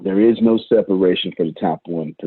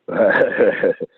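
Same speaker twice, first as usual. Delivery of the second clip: short chuckle. Real, not acted. Host chuckling while working out conference plans, short laugh. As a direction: let out a short chuckle mid-thought.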